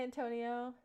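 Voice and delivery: normal talking voice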